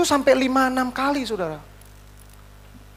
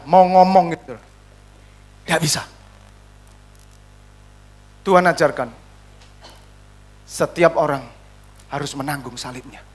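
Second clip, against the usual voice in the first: ordinary delivery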